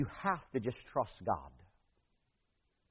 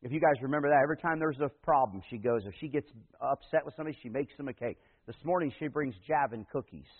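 ordinary delivery

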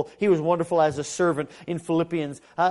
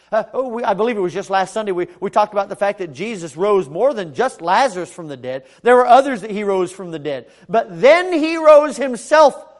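loud, emphatic preaching, building higher; full-force preaching; 4 semitones higher, 11 dB louder than usual. Acted. Preacher on Jesus rising from the dead, full-force preaching.

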